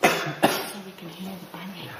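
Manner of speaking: hushed whisper. Real, not acted. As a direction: whisper so nothing hears. Whispering at noises.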